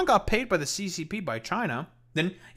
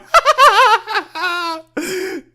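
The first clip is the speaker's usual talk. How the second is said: big, high burst of real laughter; loud laughter; 11 dB louder than usual. Real, not acted. Streamer cracking up at the covered webcam, laughing hard. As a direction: howl with laughter.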